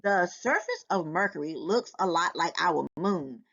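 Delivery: plain reading voice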